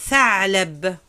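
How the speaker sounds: normal talking voice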